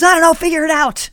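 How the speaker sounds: high-pitched mock outburst; exasperated coach. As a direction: throw the problem back, imitating a fed-up coach. Coach snapping back in quarrel.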